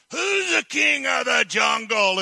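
high, loud bullying lion roar; roaring lion challenge. Acted. Lion struts up to the monkey, roaring challenge. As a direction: bellow it like a swaggering lion. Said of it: "and he says".

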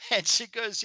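normal talking voice

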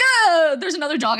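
high loud Oh then a scared report; imitating her friend's dog panic; mock alarm. Imitating Catherine spotting a wild dog, mock alarm. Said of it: "be like".

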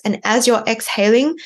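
ordinary delivery

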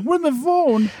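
high, drawn-out mock holler; hammed-up high-pitched searching cry; frantic phone searcher. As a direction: wail it in imitated frustration. Co-host mimics frantic phone searcher.

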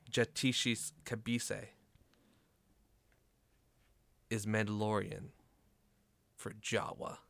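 slow, soft game master narration; suspenseful reveal. Acted. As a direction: spin out the reveal with long pauses, quietly.

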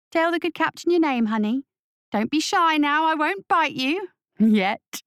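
high, cooing menace; mock-sweet witch. Witch cooing at her hostage as to a child, high and menacing. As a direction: coo to him like a child, then threaten. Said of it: "as though addressing a child".

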